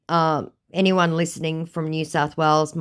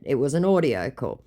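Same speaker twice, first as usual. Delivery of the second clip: normal talking voice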